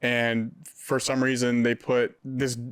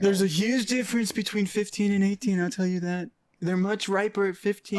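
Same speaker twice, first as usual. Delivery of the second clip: raised, put-on politician impression; Donald Trump; 8 semitones higher than usual. Comedian riffing on teenagers' ages to friends, Trump voice. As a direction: do a high-pitched presidential impression. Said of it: "I did that in Trump voice".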